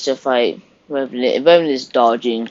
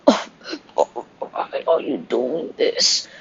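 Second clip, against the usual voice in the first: pained plea; 4 dB quieter, slower than usual. Retold or imitated. Victim being beaten.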